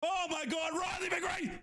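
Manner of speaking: high, amazed goal cry; elated shout. Real, not acted. Reacting to second goal.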